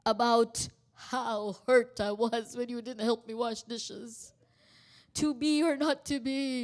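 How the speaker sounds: mock-theatrical monologue, projected; Shakespearean soliloquy. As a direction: ham it up like a stage tragedian. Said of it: "a Shakespearean soliloquy".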